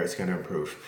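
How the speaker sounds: ordinary talk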